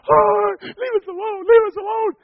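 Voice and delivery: high, loud crying plea; terrified pleading. Retold or imitated. Frightened girl begging the masked man, crying. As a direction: beg him to go away through tears.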